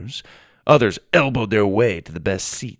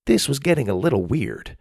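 plain reading voice

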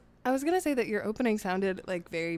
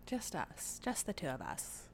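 put-on Mid-Atlantic accent; 3 semitones lower, 13 dB quieter than usual. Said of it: "Little accent?".

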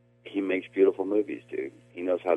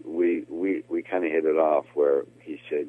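usual speaking voice

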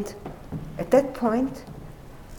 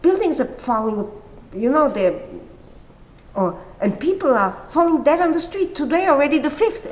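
agitated report; 7 semitones higher, 6 dB louder than usual. Retold or imitated. Retelling praying man's alarm.

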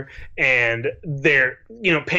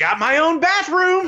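much higher put-on voice; squeaky proud sitcom brag; sitcom character voice; 13 semitones higher than usual. S0 joins Seinfeld voicing.

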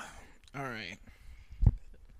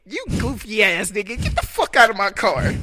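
mock shout; 7 semitones higher, 12 dB louder than usual. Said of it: "I'm like".

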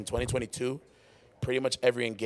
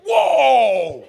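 very high, loud shout; outraged protest; 13 semitones higher, 13 dB louder than usual. Real, not acted. Host objects to guest's story, high outraged shout.

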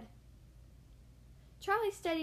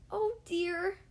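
exclaiming; 6 semitones higher than usual. Acted. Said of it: "exclaimed Charlie".

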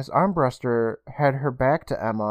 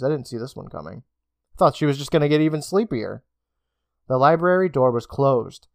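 ordinary delivery, nothing marked

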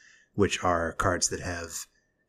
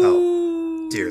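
shocked high yelp of dismay; a startled high oh; appalled; 7 dB louder than usual. Real, not acted. Appalled reaction to the howl.

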